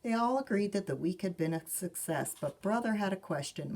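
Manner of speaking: ordinary delivery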